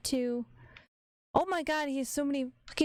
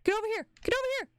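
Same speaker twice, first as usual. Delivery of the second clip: high urgent call, pitching up; 8 semitones higher, much faster than usual. Real, not acted. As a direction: call out to a teammate, higher each time. Yelling at teammate in fight.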